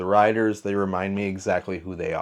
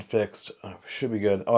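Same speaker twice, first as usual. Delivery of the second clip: ordinary talk, nothing marked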